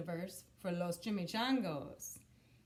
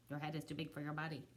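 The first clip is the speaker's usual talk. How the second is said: fast, lower, softer repeat; soft echo; 5 semitones lower, 5 dB quieter, much faster than usual. Acted. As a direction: mutter it back like an echo.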